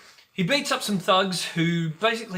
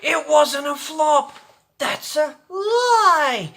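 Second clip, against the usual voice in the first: loud, high, slow mock cry; hammed-up loud high wail; mock outrage; 10 semitones higher, 10 dB louder, slower than usual. Mock dramatic correction.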